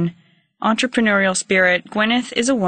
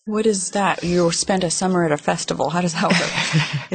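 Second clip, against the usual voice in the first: normal talking voice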